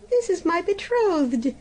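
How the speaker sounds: high, bright burst of joy; joyful exclamation. A narrator's reading. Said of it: "exclaimed the princess joyfully".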